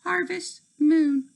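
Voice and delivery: high put-on minister voice; minister character. A narrator's reading. The minister announcing the scepter task, high put-on voice. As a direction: read the minister's lines in a lifted voice.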